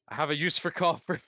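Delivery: high, loud and delighted; gleeful in character. Acted. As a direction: crow over the copper like a treasure. Player gleeful in character over copper.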